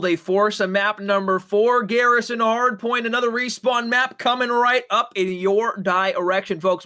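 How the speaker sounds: big, drawn-out map announcement; esports announcer. Acted. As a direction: build up slowly like an arena announcer.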